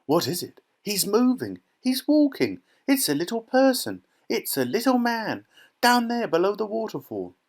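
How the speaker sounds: put-on very high, squealing child; short bursts of astonished discovery; excited screaming. A narrator's reading. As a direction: squeal each sighting as it comes. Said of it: "screams of excitement".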